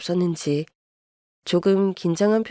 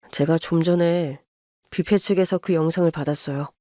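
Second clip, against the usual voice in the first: put-on lower, restrained young man apologizing; son-in-law apologizing to his wife's mother, lower and restrained; apologetic son-in-law; 5 semitones lower than usual. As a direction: hold it down, sorry but firm. Son voice again.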